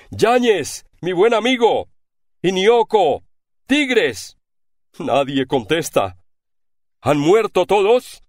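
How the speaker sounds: high, loud calls into the dark; calling desperately for lost comrades. A narrator's reading. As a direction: shout each name hoping for an answer.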